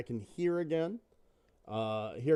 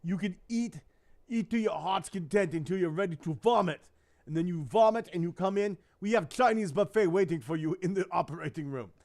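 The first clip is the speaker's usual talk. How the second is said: higher and louder, a hammed-up Caribbean accent; imitating his doctor's Caribbean accent; 4 semitones higher, 4 dB louder than usual. Retelling his doctor's promise of a buffet, in a Caribbean accent. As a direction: play the doctor with a Caribbean accent that drifts toward Austrian. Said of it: "Caribbean accent to Austrian".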